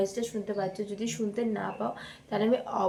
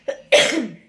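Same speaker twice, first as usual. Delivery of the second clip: a loud, sick, chesty cough; loud cough while ill; real cough; 11 dB louder than usual. Real, not acted. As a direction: cough hard from a bad cold. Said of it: "তোমরা আমার কাশি দেখি".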